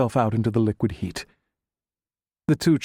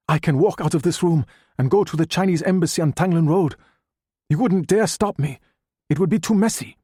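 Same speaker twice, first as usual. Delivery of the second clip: much higher, raised, daring challenge; defiant threat; 7 semitones higher than usual. A narrator's reading. Doctor Ma threatens Chang with the embassy, raised and defiant. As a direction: dare him to stop you.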